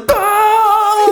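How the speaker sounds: high sung mock yelp of fright; mock scream. Podcast host mimicking Marty fleeing a bear, a high mock yelp. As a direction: yelp like a startled man.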